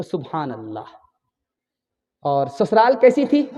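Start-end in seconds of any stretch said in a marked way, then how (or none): 0.0-0.8 hushed reply. Acted. Daughter answers mother's question.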